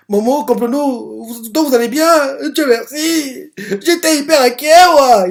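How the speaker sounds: high, slow tearful voice imitating a sobbing character; mock-weepy relief, stretched out; crying with relief. Gigi weeping with relief at seeing his friends, mock-tearful high voice. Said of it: "il est en train de pleurer".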